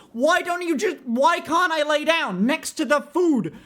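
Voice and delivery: high, incredulous questioning; worked-up, high-pitched disbelief; exasperated rant. Real, not acted. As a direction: complain in baffled outrage. Ranting about eating on beds.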